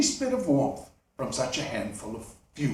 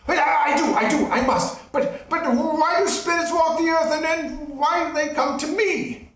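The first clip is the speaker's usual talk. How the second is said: high, loud pleading; begging for mercy; 10 semitones higher, 3 dB louder than usual. Acted. Scrooge on his knees begging the ghost for mercy. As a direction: plead on your knees in terror.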